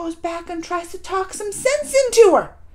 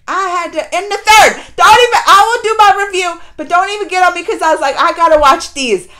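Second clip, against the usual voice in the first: louder, tumbling out fast; excited confession; 3 semitones higher, 12 dB louder than usual. Real, not acted. Viewer confessing she binged the sequels, loud and excited. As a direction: admit the binge with glee.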